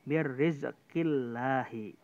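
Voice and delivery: Birrizqillahi drawn out slowly with a thin lam; thin lam recitation. Shown as a demonstration. Teacher reciting a thin lam example for the class, slow.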